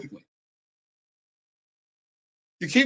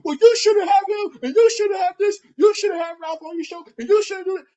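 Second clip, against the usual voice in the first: much higher, louder whiny nagging voice; shrill mocking imitation; 13 semitones higher, 5 dB louder than usual. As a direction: parody him bossing people around. Mocking the opponent's nagging orders.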